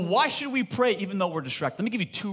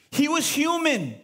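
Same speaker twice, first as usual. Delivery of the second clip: ordinary talk, nothing marked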